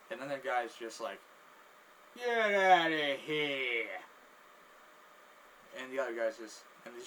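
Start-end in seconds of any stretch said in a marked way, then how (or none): 2.2-4.0 loud, very slow exclamation; drawn-out disbelief. Acted. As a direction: stretch it out loudly in mock disbelief. Guy reacts with disbelief.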